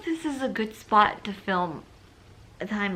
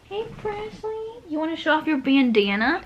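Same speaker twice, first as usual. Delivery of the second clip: high cooing pet voice; pet talk; 8 semitones higher, 3 dB louder than usual. Real, not acted. Owner calling her dog Presley, high cooing voice. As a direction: coo at the dog, pitched up.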